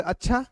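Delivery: अच्छा with a questioning rise; demonstrated question on अच्छा; questioning tune. Shown as a demonstration. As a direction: ask अच्छा, lifting the last syllable. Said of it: "ये होता है प्रश्नवाचक".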